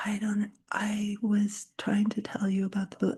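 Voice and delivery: whispered, barely audible reply; imitated timid whisper. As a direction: whisper as if too shy to be heard. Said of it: "spoke like this".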